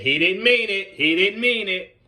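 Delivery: loud, high, firm call across the court; urgent call. Real, not acted. Coach calling out to kids after the ball hits one, loud urgent call. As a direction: cut off trouble before it starts.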